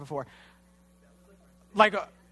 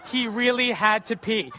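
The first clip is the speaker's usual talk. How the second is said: slow, laughing readout of "He really had to pee"; amused, slow reading of the cop's note; 3 semitones higher, 4 dB louder, slower than usual. Retold or imitated. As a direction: chuckle through it as you read it out slowly. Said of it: "she writes this down on a piece of paper".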